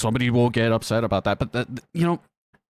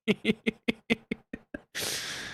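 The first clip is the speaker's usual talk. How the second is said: high, soft run of giggles; real giggling; 9 semitones higher, 7 dB quieter than usual. Real, not acted. Cracking up at own illusionist joke, high giggling. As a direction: crack up, trailing away.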